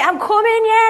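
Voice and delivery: high loud flustered answer; flustered call back. Retold or imitated. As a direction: shout back to the kid while busy. Answering son while praying.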